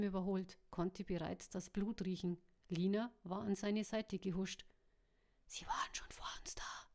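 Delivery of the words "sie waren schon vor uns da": unvoiced, hushed whisper. A narrator's reading. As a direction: whisper it so only the one beside you hears. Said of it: "flüsterte er".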